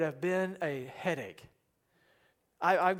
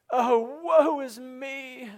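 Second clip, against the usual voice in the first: higher, slow hammed-up wail; mock lament; 5 semitones higher, 4 dB louder, much slower than usual. Preacher acting how lament psalms often start, hammed-up lament. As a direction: moan it theatrically, stretched out. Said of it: "in the lament psalms, it often starts with".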